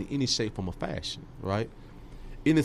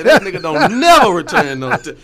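laughing, louder and higher; laughing quote; 8 semitones higher, 13 dB louder than usual. Retold or imitated. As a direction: laugh through the mock complaint. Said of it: "they say".

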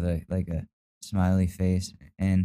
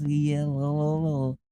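mocking girly voice; 7 semitones higher than usual. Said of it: "mocking his text".

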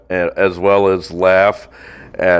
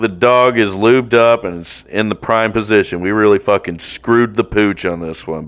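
ordinary talk, nothing marked

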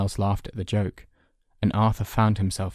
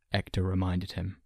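plain reading voice

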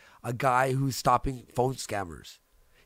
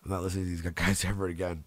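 laughing; 9 semitones lower, 6 dB quieter, faster than usual. Retold or imitated. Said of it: "I'm like".

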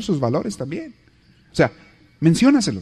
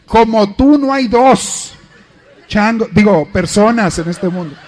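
higher, louder, slow and syrupy; sweet flattery; 5 semitones higher, 5 dB louder than usual. Retold or imitated. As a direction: coo it slowly to your husband, laying it on thick. Wife flattering husband.

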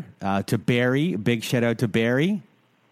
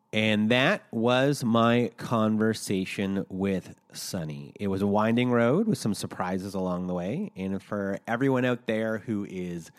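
measured, softer recorded sign-off; podcast outro read; 5 dB quieter than usual. Real, not acted. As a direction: read the closing message quietly and deliberately. Recorded outro with helpline numbers.

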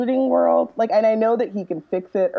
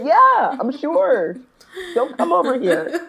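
higher, exasperated "yeah, I'm sure"; mock annoyed reply; 5 semitones higher, slower than usual. Answering an unsubscribe email, exasperated. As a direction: brush off a pushy email, fed up.

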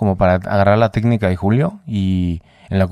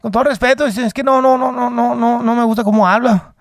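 imitating Julio; 13 semitones higher than usual. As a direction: play the offended boxing legend, pitched up. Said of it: "empecé a hablar igual que él".

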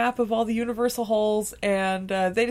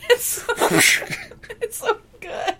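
giggling delight; 10 semitones higher than usual. Acted. Gleeful punched peasant.

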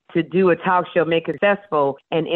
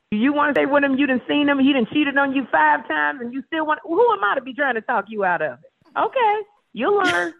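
much higher, sassy exasperation; sassy mock lecture; 9 semitones higher than usual. Friend who stays with a cheater, higher sassy exasperation. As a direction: roll your eyes at her bad choices.

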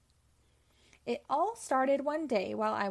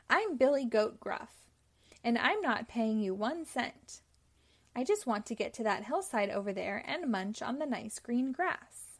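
usual speaking voice

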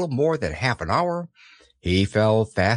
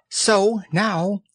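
lightly voiced timid piglet; a small anxious voice; 8 semitones higher than usual. A narrator's reading. Piglet thinking to himself.

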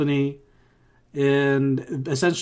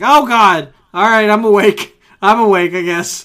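loud, higher mock alarm; jolted awake; 6 semitones higher, 10 dB louder than usual. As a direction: exclaim as if blasted out of drowsiness. Acting jolted awake by bright screen.

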